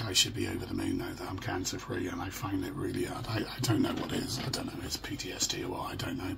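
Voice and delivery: ordinary talk, nothing marked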